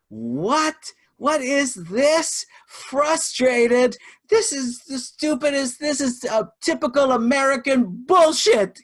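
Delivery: very high, loud imitated screaming rant; outraged scream. Course attendee screams at the instructor over a slide, outraged. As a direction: build from a rising "What?" into drawn-out, high-pitched yelling. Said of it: "screams at me and goes".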